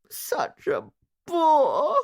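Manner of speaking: high, loud, slow sobbing woman. A narrator's reading. Upset wife sobbing to her friend about her husband. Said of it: "sobbed Missus Hartford".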